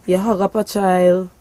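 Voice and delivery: ordinary delivery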